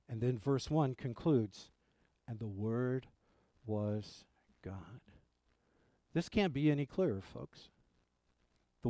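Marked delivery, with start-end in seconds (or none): 2.3-5.0 hushed whisper. Acted. Quoted verse, dropping to whisper.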